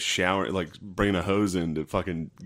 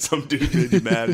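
laughing; 6 semitones higher, 5 dB louder, slower than usual. Real, not acted.

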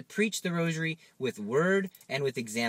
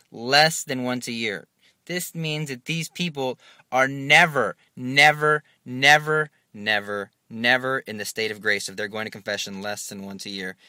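drawn-out, louder pounding of never; slow emphatic never; 6 dB louder than usual. Acted. Preacher warning the parish, pounding never. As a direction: hammer every never home.

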